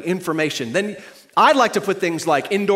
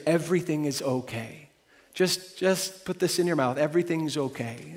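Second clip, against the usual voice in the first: soothing hush; 3 semitones lower, 7 dB quieter than usual. Retold or imitated. Voicing the pacifier's soothing.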